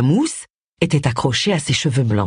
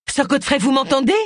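higher-pitched urgent question, rising at the end; trying to get through to someone hurt; raised, urgent voice; 9 semitones higher than usual. A narrator's reading. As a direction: check if he can hear you, voice raised in pitch. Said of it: "s'exprimer d'une voix forte".

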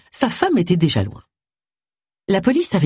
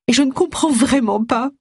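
high, plaintive moan of a grieving sister; a lightly voiced lament, pitched high; 8 semitones higher than usual. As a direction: whimper it out in disbelief. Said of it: "gémit-elle".

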